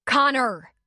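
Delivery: high, loud shout of a name. A narrator's reading. Leslie shouting at Connor from the porch, sharp shout. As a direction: call him off sharply. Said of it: "Leslie shouted from the porch".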